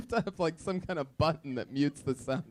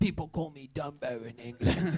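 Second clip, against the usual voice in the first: aggrieved English guest impression; mock British accent. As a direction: laughingly ham up a wounded Brit. Host parodies British guest's complaint.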